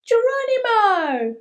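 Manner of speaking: high, loud Geronimo yell; gleeful leap cry. Acted. Boy belly-flopping onto the table, joyful high yell. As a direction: shout it as you dive.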